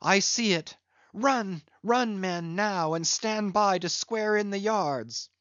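beg, then call the men excitedly; desperate pleading. Acted. Stranger begging, then urging men.

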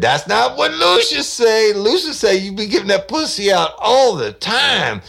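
loud, brash retort; crude caller. Retold or imitated. Prank caller shouting back at the woman, loud and crude. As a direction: bark it back at her.

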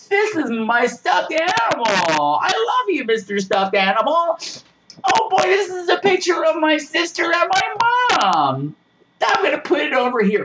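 hammed-up sweet naive girl; mocking the actress. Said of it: "which she has to narrate".

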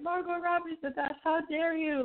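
high-pitched outraged fan imitation; mock indignation. Fan defending Margot Robbie in the comments, mock-indignant. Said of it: "then you have people like".

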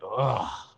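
imitated groan of sitting down; effortful grunt. Shown as a demonstration. Host imitates noises made sitting down, effortful grunt. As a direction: grunt like an aching older body. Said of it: "making them noises like".

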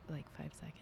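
soft ASMR whisper. Real, not acted. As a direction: drop into a whisper. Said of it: "Can we do, like, an A S M R".

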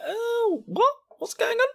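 very high, baffled squeak; high-pitched comic surprise; mock bewilderment. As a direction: react with put-on confusion. Acts out a baffled viewer's reaction.

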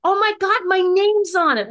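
thrilled cry on seeing her name in the post; excited shock. Retold or imitated. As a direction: squeal it as if you just spotted your name. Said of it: "I went".

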